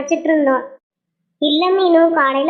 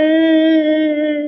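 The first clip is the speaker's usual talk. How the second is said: sobbing; much slower than usual. Acted. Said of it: "எதுக்கு இப்படி அழுதுட்டு இருக்க".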